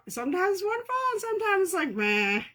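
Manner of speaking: high fast then flat meh; sing-song then shrug; wonderful versus meh. Real, not acted.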